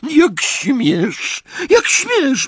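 very high, loud squealing; shrill squeak. A narrator's reading. Aunt squealing at Harry's insult. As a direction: squeak in outrage. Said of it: "pisnęła ciotka Petunia".

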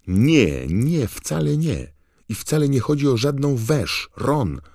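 high, loud, flustered denial; indignant protest. A narrator's reading. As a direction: protest hotly, voice jumping way up. Hermiona indignantly denies Ron's accusation. (oburzony, wysoki i głośny protest)

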